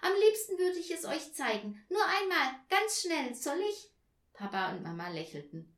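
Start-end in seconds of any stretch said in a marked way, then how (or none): 0.0-3.9 put-on high, loud, bursting child; overjoyed excitement. A narrator's reading. Tina wriggling in bed to show the present, excited. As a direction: plead eagerly, rising to a high question. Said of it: "ganz aufgeregt vor lauter Freude".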